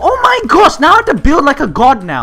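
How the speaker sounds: high, very loud outburst; frantic shouting. Real, not acted. Gamer attacked while building, frantic high shouting. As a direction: shriek in exasperation at the game.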